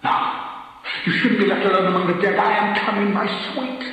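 high, loud yearning call; husband calling to his dead wife Kate; longing cry. A narrator's reading. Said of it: "sudden sharp change of voice".

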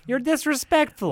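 high-pitched offended retort; aggressive. Acted. Guest answering host's telling-off, aggressive. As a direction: throw it back at him, high and heated. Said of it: "you don't need to get so aggressive".

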